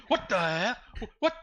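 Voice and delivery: high, loud startled yelp; distraught bewilderment. Acted. Orc hearing a voice in his brain, baffled. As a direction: react in comic alarm to a mystery voice. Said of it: "gonna look extremely just distraught".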